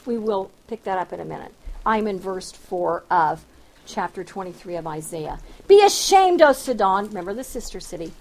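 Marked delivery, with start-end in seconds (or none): 5.7-7.0 forceful scripture reading. Acted.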